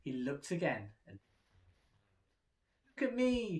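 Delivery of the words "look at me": high loud proud cry; excited cry. A narrator's reading. Splat admiring himself in the mirror, excited. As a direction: crow it proudly. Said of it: "he cried".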